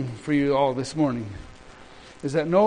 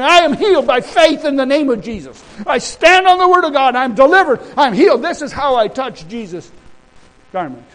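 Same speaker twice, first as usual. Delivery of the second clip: high, loud declarations of faith; full-force preaching; 9 semitones higher, 9 dB louder than usual. Real, not acted. Preacher showing how to declare God's promises, full-force preaching. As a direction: proclaim it boldly to the whole room.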